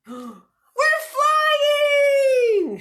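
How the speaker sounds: high, loud joyous shout; excited shout. Acted. Moo shouts to Minnie as the tractor takes off. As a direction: shout with wild delight. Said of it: "shouted Moo".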